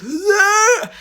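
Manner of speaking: long, very high throaty mock scream; imitated abnormal shriek. Girl showing the shriek that cost her voice, imitated scream. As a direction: screech as if the larynx dropped. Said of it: "It wasn't a normal shriek".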